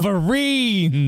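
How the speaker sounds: excited. Real, not acted. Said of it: "most excited to hear about".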